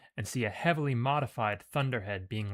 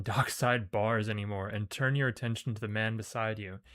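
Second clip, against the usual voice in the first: ordinary talk, nothing marked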